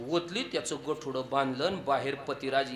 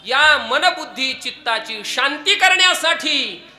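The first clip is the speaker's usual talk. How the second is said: loud, declamatory kirtan preacher; emphatic sermon delivery; forceful preaching; 9 semitones higher, 9 dB louder than usual. Real, not acted. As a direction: drive the point out to the congregation.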